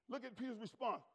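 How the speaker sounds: ordinary talk, nothing marked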